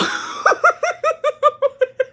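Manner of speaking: long high laugh; real laughter. Real, not acted. Retelling the border stop, breaking into laughter. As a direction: lose it laughing.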